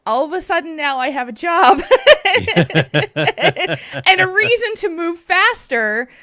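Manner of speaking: laughing. Real, not acted. Laughing about sudden job news.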